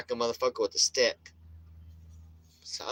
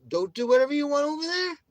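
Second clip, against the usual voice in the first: very high, loud acted dismissal; high-pitched mock shout; 5 dB louder, faster than usual. Man telling intruders to go elsewhere, high mock shout. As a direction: send them off in a high raised voice.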